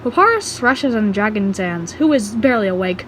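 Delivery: ordinary delivery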